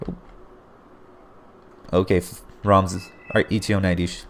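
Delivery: ordinary talk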